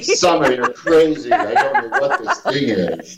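slow, put-on voice through laughter; laughing mock denial. Teasing Summer, laughing in a put-on voice. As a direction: deny it while cracking up.

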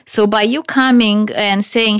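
normal talking voice

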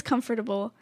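"comfortable" as CUM-fer-tuh-bul; every syllable sounded. Retold or imitated. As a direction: give "comfortable" all four syllables. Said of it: "seven billion syllables".

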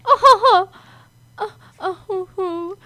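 high mock whimper sliding into crying; imitating a little boy starting to cry. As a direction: whimper oh like a child about to cry. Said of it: "almost starts crying".

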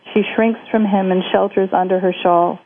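usual speaking voice

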